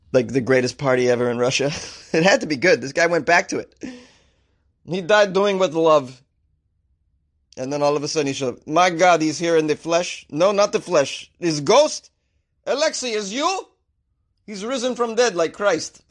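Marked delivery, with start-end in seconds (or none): 4.8-6.2 slow, mock-solemn Russian accent; hammed-up Russian mourner; terrible Russian accent. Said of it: "how terrible my Russian accent is". 8.7-16.0 astonished mourner in a bad Russian accent; hammed-up Russian disbelief; terrible Russian accent. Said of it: "how terrible my Russian accent is".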